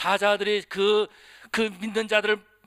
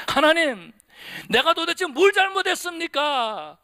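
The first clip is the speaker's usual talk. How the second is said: higher, slow aggrieved protest to God; complaining to God; 8 semitones higher, 5 dB louder than usual. Retold or imitated. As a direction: play the wronged believer grumbling upward. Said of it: "그러면서 불평할 때가 많이 있다". (하나님께 억울하게 불평하는 목소리)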